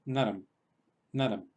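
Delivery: naram ending in a clear closed m; final m demo. Shown as a demonstration. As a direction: say naram, closing the lips on the m. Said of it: "como si terminara en M".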